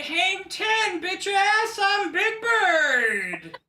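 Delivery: high, goofy bird voice, slow at the end; Big Bird from another room. Acted. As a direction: call the catchphrase from next door. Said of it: "from the other room".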